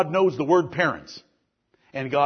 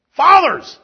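high, loud shouted repetition; emphatic shout; 12 semitones higher, 10 dB louder than usual. Real, not acted. Pastor shouting fathers at the congregation, loud and high. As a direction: hurl the word out at the men.

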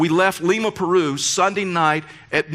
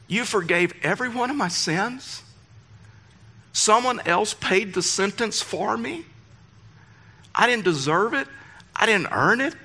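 high, slow wondering questions; awed disbelief; 5 semitones higher than usual. Acted. New believers amazed at forgiveness, awed disbelief. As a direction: marvel slowly, pitch raised, with long pauses.

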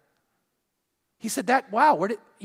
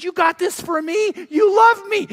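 high, loud delighted cry; excited wife; 10 semitones higher, 6 dB louder than usual. Retold or imitated. Wife thanking husband, loud high delighted cry. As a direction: gush with thrilled joy. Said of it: "She goes".

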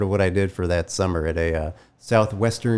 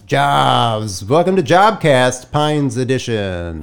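loud, higher drum-roll reveal; show announcer; 4 semitones higher, 3 dB louder, slower than usual. Pretend. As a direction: announce it big to the listeners. Announcing the episode topic after drum roll.

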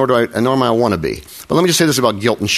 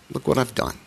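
soft quote; 9 dB quieter than usual. Retold or imitated. Quoting Bohemian Rhapsody line.

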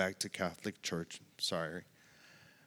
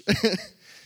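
sudden high, louder burst of laughter; 7 dB louder than usual. Real, not acted. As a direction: break into a bright laugh. Laughing at family's shock.

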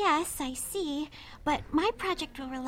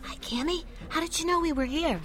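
library whisper; 2 semitones higher than usual. Real, not acted.

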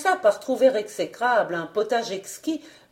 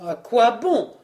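ordinary delivery

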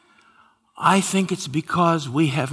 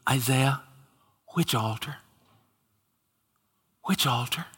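quiet wondering question; 6 dB quieter than usual. Pretend. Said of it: "it's gonna be".

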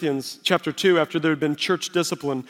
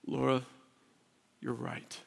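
low, very soft admission; quiet concession; 4 semitones lower, 14 dB quieter than usual. Retold or imitated. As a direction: give in gently to your wife. Husband concedes argument to wife.

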